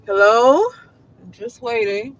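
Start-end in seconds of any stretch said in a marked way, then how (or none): none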